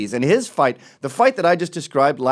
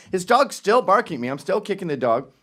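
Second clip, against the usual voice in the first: ordinary delivery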